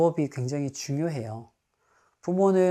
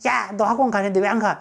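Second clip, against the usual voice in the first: higher, louder scolding yell; angry mother yell; 6 semitones higher, 6 dB louder than usual. Shown as a demonstration. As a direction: yell at the kid for skipping class. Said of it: "이게 유 메시지잖아요". (학원 안 간 아이에게 화내며 소리치기)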